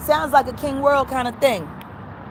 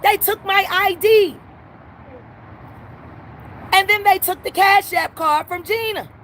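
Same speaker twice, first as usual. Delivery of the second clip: higher, angrier complaint at the window; heated rant; 5 semitones higher than usual. Real, not acted. Customer at the window complains about staff, heated. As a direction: let them have it, fed up.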